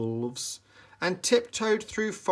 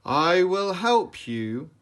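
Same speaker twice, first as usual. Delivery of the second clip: wizard commanding; 7 semitones higher, 7 dB louder than usual. A narrator's reading. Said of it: "he said".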